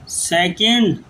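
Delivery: word-by-word dictation with long pauses; slow dictation. Acted. Teacher dictating a grammar rule to students, slow dictation. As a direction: dictate slowly, giving time to write.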